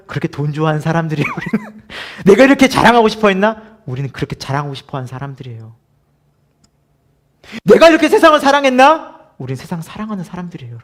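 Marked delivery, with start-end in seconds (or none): 2.3-3.8 mock self-reproach. 7.6-9.2 louder, higher mock dismay at oneself; exclaiming in shocked self-recognition; loud mock self-reproach.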